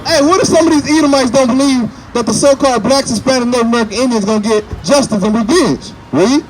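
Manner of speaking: loud, high street-preacher delivery; preaching. Real, not acted. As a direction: preach it out to the crowd.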